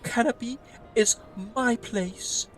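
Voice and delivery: high, theatrical elf innkeeper; overjoyed fantasy innkeeper voice; gushing elf innkeeper. Acted.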